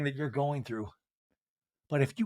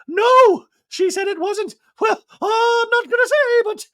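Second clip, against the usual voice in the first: much higher, louder, whiny mock Dorit voice; 6 dB louder, slower than usual. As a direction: play her as a caricature about to cry. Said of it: "And Dorit's like".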